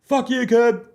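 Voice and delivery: high, loud, drawn-out imitated holler; long-distance call. Fan hailing comedian from three holes away, imitated holler. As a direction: holler it slowly across a golf course. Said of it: "someone will three holes away will go".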